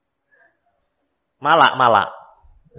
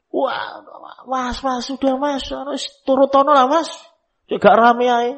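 high-pitched mock pleading; pleading spouse; 13 semitones higher than usual. Spouse begging husband to give in to crying child, pleading. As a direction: plead in a raised, put-on voice.

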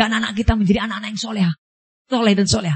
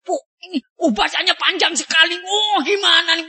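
impatient worshipper; 7 semitones higher than usual. Retold or imitated. Worshipper fretting over long recitation.

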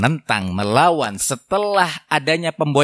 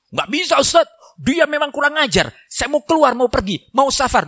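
higher, complaining husband protesting; angry husband; 9 semitones higher than usual. Acted. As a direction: play the fuming husband who wants to leave. Angry husband voiced by preacher. (suami yang marah, nada tinggi mengeluh)

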